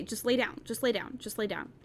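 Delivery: coaxing the cat. Real, not acted. Coaxing her cat to lie down.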